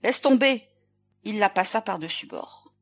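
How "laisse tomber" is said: loud, raised-pitch bellow; bellowed order. A narrator's reading. Captain to sailor holding the countess, bellowing. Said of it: "et quand Olsen beugla".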